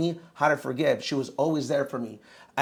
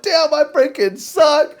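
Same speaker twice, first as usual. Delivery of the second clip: self-pitying whine; 10 semitones higher, 10 dB louder than usual. Retold or imitated. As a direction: ham up feeling sorry for yourself. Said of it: "I felt so sorry for myself".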